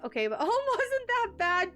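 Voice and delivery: high amused quote with a laugh; high giggly self-quote. Retold or imitated. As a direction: laugh through the thought, pitched well up. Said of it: "I'm like".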